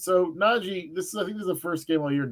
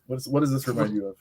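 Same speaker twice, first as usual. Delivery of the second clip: usual speaking voice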